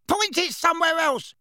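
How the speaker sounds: very high frightened demand; high alarmed protest; panicked. A narrator's reading. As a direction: beg him off in panic, pitch shooting up. Backed against wall by wand.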